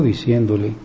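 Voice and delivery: ordinary talk, nothing marked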